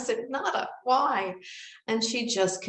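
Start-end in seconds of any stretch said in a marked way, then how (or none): 0.3-1.3 slow, high-pitched mock plea; retold plea. Woman pleading with silent Nada for an answer, mock plea. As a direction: plead upward, stretching the "why".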